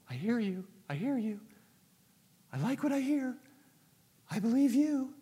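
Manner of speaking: high, softened voice of someone being won over; lured sinner. Acted. Acts himself lured toward the wall.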